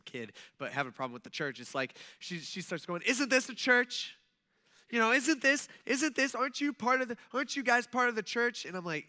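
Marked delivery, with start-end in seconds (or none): 3.1-8.7 higher, louder accusing questions; mock indignant yelling. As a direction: imitate an upset woman scolding. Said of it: "she starts screaming and starts yelling".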